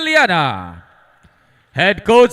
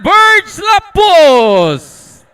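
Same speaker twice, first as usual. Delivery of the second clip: higher, louder, stretched player name; hyped name call; 6 semitones higher, 4 dB louder, much slower than usual. Acted. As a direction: pump up the crowd on the name. Home starter's name called.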